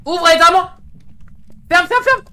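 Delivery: very high, loud panicked yell; panicked screaming. Real, not acted. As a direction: scream for help in terror. Ghost attacking, door panic.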